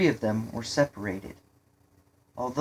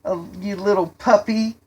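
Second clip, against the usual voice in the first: put-on louder, higher boyish jeer with a laugh; spewed-out taunt; 7 semitones higher, 7 dB louder than usual. A narrator's reading. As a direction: gleefully rub it in. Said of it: "spewed out".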